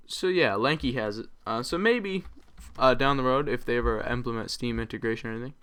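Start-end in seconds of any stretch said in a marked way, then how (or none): none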